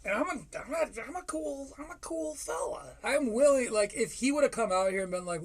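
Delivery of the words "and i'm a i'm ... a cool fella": small, high, soft mocking voice; mock cool fella. Host mocking the comedian's self-image, high soft put-on voice. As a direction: play a self-styled cool guy, softly and high.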